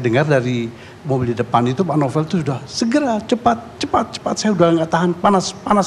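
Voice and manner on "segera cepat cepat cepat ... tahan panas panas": imitating a desperate plea in pain, pitched higher; retold urgent plea. Retold victim begging to hurry. (tiruan permohonan panik kesakitan, nada tinggi)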